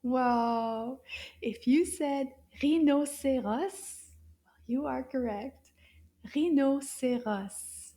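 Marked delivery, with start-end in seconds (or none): 6.3-7.9 slow model word. Shown as a demonstration. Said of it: "Wow, that's a long one".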